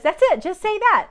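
loud, high-pitched insistence; emphatic retold assurance. Spiritual mom telling her to just pray, insistent. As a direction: insist in a lifted, imitated voice.

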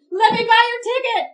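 high, loud blurted call; sudden loud offer. Retold or imitated. Woman blurting out an offer to a stranded Marine, sudden loud outburst.

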